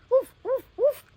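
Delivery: high, loud playful woof woof; barking dog. Pretend. Child reading a picture book, barking for the dog. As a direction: bark it out like a puppy. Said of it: "Dog say".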